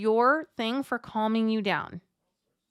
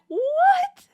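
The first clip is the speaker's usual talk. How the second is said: very high, loud squeak of disbelief; a shrieking "What?" at the absurd request; overwhelmed mom's high incredulous yelp; stunned disbelief; 6 dB louder than usual. Retold or imitated. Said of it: "And you're just like".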